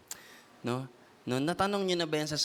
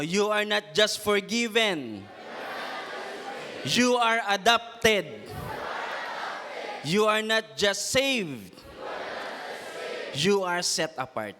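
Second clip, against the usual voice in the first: louder and higher, slow and deliberate; a preacher's slow, raised call, each phrase set apart by pauses; louder, for the neighbor to hear; 4 semitones higher, slower than usual. Shown as a demonstration. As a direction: proclaim it slowly so your neighbor hears every word. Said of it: "masyado yatang mahina yung boses mo".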